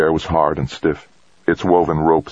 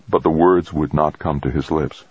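ordinary delivery, nothing marked

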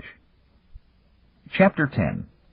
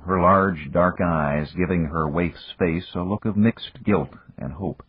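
usual speaking voice

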